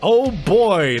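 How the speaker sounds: ordinary delivery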